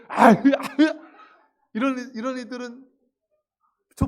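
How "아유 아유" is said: high, very loud mock yells; brash, tough girl. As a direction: play a pushy, forceful girl yelling. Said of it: "근데 막 힘세고 드세고". (드세고 힘센 여자 흉내로 높고 크게)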